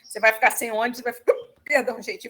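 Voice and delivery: normal talking voice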